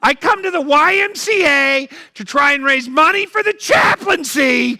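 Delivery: high, loud comic outrage; exasperated shout. Real, not acted. Comic yelling at the audience for not clapping, exasperated shout.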